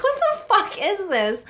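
high, slow, laughing what the; incredulous, cracking up; retelling her shock while laughing; laughing disbelief. Retold or imitated.

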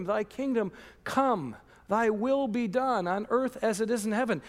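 hushed prayerful Thy kingdom come; prayer recitation. Acted. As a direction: let the prayer fall away quietly.

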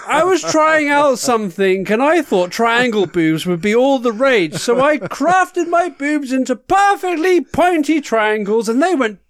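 campy drag queen. Acted. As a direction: gush every word like a glamorous diva. Host character, campy darling persona.

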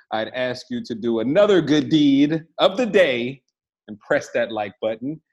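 booming showman announcer; ringmaster. Acted. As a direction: roll up, roll up, welcome the crowd. Podcast host opens like a showman.

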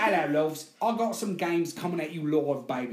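hammed-up British accent; chirpy mock British patter; Russell Brand. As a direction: greet the crowd in a put-on British accent. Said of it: "Are you Russell Brand right now?".

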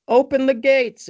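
high, loud mock shout; imitated calling out. Retelling the cry heard at the gate, mock yell. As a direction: call it out like a hammed-up herald. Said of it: "you hear".

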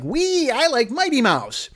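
put-on high, slow childlike gush; innocent cosplayer. Innocent cosplayer gushing about Mighty Mouse, high childlike. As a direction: gush like a happy kid. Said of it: "people who are just like".

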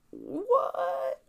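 high, stunned what; shocked what. Retold or imitated. Reading the text that grandma died, high shocked what. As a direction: gasp it out in disbelief.

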